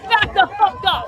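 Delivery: loud, high angry yell; furious shout at police. Real, not acted. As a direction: yell it at the officers.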